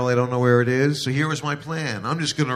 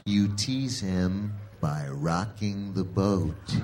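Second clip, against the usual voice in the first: soft slow instruction; 4 semitones lower, 6 dB quieter, much slower than usual. Acted. Wife's sultry instruction.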